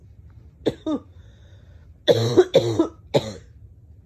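long low chesty cough; deep hacking cough. Real, not acted. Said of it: "see what I mean?".

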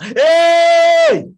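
loud high call to Daddy; a child's sudden high shout. Acted. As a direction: call out across the yard. Acted child calling father.

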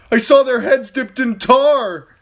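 high, loud desperate yell; frantic shout. A narrator's reading. Theon yelling at the dream wolves, frantic shout. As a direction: scream it at the dead wolves. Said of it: "he tried to shout".

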